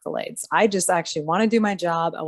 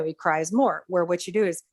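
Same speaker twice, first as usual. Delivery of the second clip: ordinary delivery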